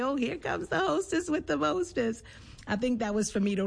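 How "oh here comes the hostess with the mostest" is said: high-pitched, playful imitation of a teasing grandfather. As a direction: tease fondly in a much higher voice. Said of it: "would tease and say".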